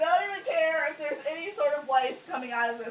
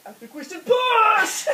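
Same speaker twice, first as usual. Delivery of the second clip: loud, high, drawn-out mock order; urging body; 5 semitones higher, 9 dB louder, slower than usual. Body overruling the woman in labor, loud urging. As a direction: build to a big, stretched PUSH. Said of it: "my body's like".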